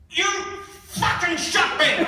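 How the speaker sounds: high, loud, slow stunned yell; shocked yell. Retold or imitated. Wounded dad yells at son, slow shocked shout. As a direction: yell it out in disbelief. Dad just got shot.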